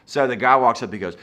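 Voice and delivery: normal talking voice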